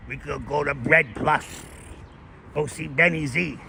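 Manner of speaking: hint of a Bay Ridge wiseguy plugging local spots; William DeMeo impression. Streamer imitating an actor for his chat, lightly put-on Brooklyn voice. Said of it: "William DeMeo kinda talks like this".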